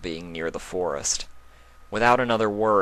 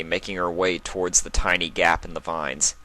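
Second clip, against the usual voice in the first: ordinary talk, nothing marked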